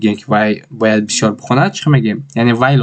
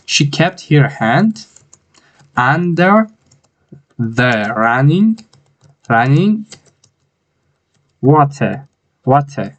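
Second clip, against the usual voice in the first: slow, each word set apart with pauses; word by word dictation; 2 semitones higher, much slower than usual. Shown as a demonstration. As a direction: dictate one word at a time. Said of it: "building sentence word by word". (کلمه به کلمه با مکث)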